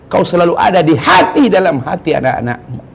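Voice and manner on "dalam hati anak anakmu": ordinary delivery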